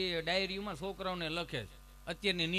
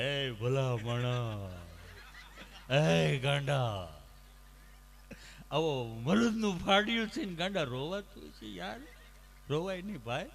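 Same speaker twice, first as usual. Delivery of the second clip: choked up doctor; 5 semitones lower, slower than usual. Acted. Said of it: "doctor સાહેબ ગળગળા થઈ ગયા".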